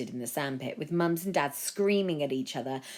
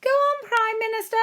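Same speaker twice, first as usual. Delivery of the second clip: much higher, louder egging-on call; voice from the crowd; 8 dB louder than usual. A narrator's reading. Came a voice from the crowd.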